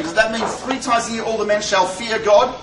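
ordinary delivery

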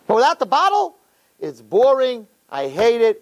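high-pitched mock whining protest; petulant complaint. Acts complaint about Shabbos.

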